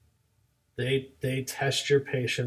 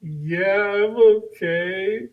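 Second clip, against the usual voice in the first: high, loud, stretched mock wail; imitated crying child; 7 semitones higher, 8 dB louder, much slower than usual. Little girl insisting to her teacher, mock crying. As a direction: put on a tearful kid's drawn-out cry. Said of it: "and she's crying".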